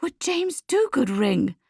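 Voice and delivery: slow, high-pitched wary question; careful. A narrator's reading. Lucia asking about the caller, careful and slow. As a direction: ask it slowly, feeling your way. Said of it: "Lucia asks carefully".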